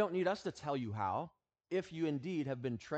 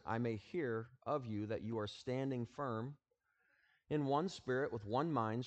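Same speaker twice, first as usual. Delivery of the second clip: scripture reading; 3 semitones lower than usual. Acted. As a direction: read the passage with reverent weight. Reads scripture passage aloud.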